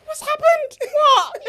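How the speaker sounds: loud, high mock-panicked questions; alarmed mum. Imitating mum hearing her wail, alarmed shout. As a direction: cry out in hammed-up alarm.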